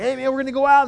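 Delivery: high, loud party buddy; pushy buddy. Retold or imitated. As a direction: hype him up to go out. Old friend calling up.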